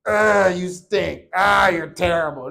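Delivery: low, drawn-out mock disgust; put-on slow, low scolding voice. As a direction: drag out each insult in imagined disapproval. Imagined scolding by his wife.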